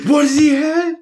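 very high, loud mock query; baffled airport questioner. Airport scene from Pink Panther, baffled high-pitched question. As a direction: squawk it in comic bafflement. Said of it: "trying to figure out what he has".

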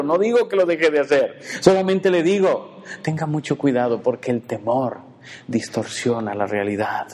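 ordinary talk

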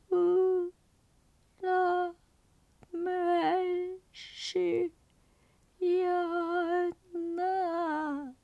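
high, thin ghostly wail. Acted. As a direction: moan it like a pitiful ghost. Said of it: "こういう哀れな声が聞こえた".

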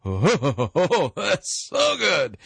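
mock sip of wine, swooning with pleasure; savoring delight. Host imitating someone tasting aged wine, laughing and swooning. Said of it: "you sip it and go".

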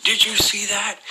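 high-pitched, shocked exclamation. Retold or imitated. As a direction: retell your shock, voice shooting right up. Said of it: "it shocked me".

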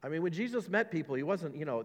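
ordinary talk, nothing marked

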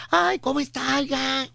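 high thin everyday voice; squeaky voice. Retold or imitated. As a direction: imitate the host off the air, squeaky. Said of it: "cuando los conoces en persona". (voz de pito)

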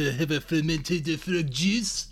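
put-on slow, clumsy French accent; a clumsy French accent, taken slowly; best French accent. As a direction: try your best French accent and miss. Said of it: "That's my best French I got".

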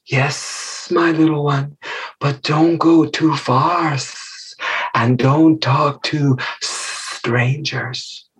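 slow warning with drawn-out s sounds; hissing mother snake. Acted. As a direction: stretch every S into a snake's hiss.

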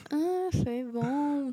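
very slow, dragged half-hearted "foi bom"; to her in-laws, a half-hearted unconvincing "foi bom"; unconvincing reply. Retold or imitated. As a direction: pretend it went fine and fail. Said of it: "com aquela cara de merda".

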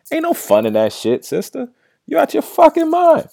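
high-pitched, loud indignant outburst; incredulous shriek telling the mother off; outraged scolding. Acted. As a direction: scold her like she's lost it, voice flying up. Scolding Keaton's mom directly.

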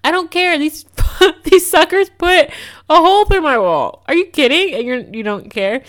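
loud, high frustrated outburst; really fed up, letting it rip; exasperated rant. Real, not acted. Ranting to listeners about the apartment complex, loud and exasperated.

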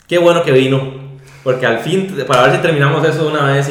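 loud, eager welcome, rushing at the end; eager greeting. Retold or imitated. The client welcoming Max at the office, loud, eager and rushing. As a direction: greet him like you've been waiting for ages, speeding up.

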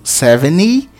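SEVENTY as "seven-ee", T missing; seventy without T. Shown as a demonstration. Teacher repeating for learners, seventy without T. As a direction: join N straight to Y in SEVENTY. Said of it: "como se não tivesse o T".

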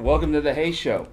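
big, loud show-host welcome; hearty greeting. Acted. Co-host redoes show opening.

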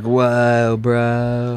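drawn-out mock surfer dude. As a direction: drag each word like a surfer. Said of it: "like, surfer L A voice".

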